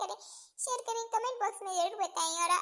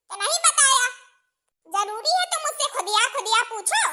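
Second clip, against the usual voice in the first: a higher, scolding mother-in-law voice; bossy mother-in-law; 5 semitones higher, 8 dB louder, faster than usual. Acted. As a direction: lay down the law like a village mother-in-law.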